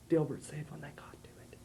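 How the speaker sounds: unvoiced whisper. A narrator's reading. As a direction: breathe the tag out unvoiced. Whispered narration tag.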